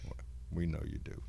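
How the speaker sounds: ordinary talk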